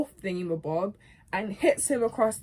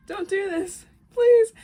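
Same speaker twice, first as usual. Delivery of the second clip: high plea, bursting loud at the end; imitated begging; 12 semitones higher, 10 dB louder than usual. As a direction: plead desperately, building to a cry. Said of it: "he's begging now".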